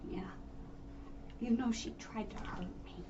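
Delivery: hushed whisper. Real, not acted. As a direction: whisper it close. Whispered aside.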